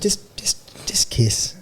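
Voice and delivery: softly whispered just kiss; coaxing whisper. Retold or imitated. Leaning in to Sandra, whispering a coaxing plea. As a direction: coax her in a hush.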